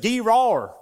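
Gerar with a loud high southern twang, GEE-rar; Gerar, southern accent demo. Shown as a demonstration. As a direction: say Gerar in a thick southern drawl, kee-RAR. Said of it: "So my southern accent".

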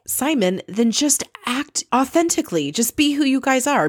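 exasperated, tell him to just be real; exasperated advice. Pretend. Talking back to Simon.

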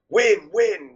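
high, loud imitation of a pushy father; mock pushy dad. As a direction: goad him like an overbearing dad. [name] imitating his pushy dad.

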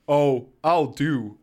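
slow, knowing hint of mystery; mysterious. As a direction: draw it out mysteriously. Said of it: "says mysteriously".

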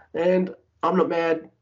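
normal talking voice

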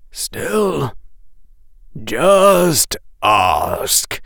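mildly tired professor; a little weary. A narrator's reading. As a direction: ask with a touch of weariness. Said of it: "sounding a little weary".

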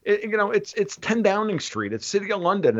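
ordinary delivery

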